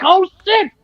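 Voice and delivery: high, excited fan cry; excited mark-out. Retold or imitated. To himself watching SmackDown, excited fan yelp. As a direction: pop like a fan seeing a legend. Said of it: "I'm like".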